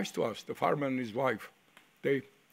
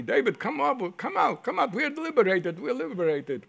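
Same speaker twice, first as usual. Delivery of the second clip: much higher and louder, calling out with joy; excited yelling; 6 semitones higher, 4 dB louder than usual. Retold or imitated. Friends calling David out of the outhouse, excited yell. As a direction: shout the news to a friend inside. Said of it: "my friends from the outside yelled at me".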